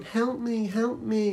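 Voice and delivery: high, soft mock cry for help; pathetic plea. Mocking Harry's pleas to a co-host, high and feeble. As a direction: plead feebly like a damsel in distress.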